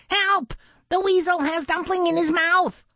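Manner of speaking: very high panicked duckling voice; ducklings quacking in alarm. A narrator's reading. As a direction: squeak out the alarm like a baby duck. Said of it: "the other ducklings quacked".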